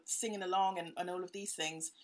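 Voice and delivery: ordinary delivery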